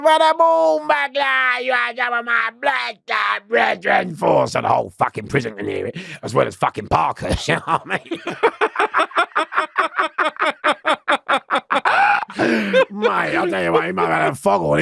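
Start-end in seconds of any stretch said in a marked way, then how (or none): 0.0-4.4 very high, loud, slow shouted challenge; aggressive gang shout. Retold or imitated. Gang member shouting at him in the yard, loud slow shout. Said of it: "So he goes". 8.2-12.8 long high laugh. Real, not acted. Laughing at the story.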